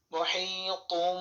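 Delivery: মহিতুম very slow with humming মিম; iqlab ghunna. Shown as a demonstration. Teacher modelling iqlab for students. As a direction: stretch মহিতুম and hum the ending. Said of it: "উচ্চারণ হবে".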